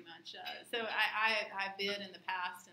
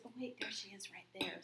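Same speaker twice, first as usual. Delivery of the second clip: whisper; 4 dB quieter than usual. Retold or imitated. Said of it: "and she goes".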